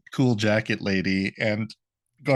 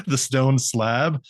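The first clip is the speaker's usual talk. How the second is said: usual speaking voice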